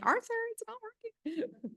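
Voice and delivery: a quick high-pitched joking complaint; mock complaint. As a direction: play it as a playful mock whine at the tech guy. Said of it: "I'm like".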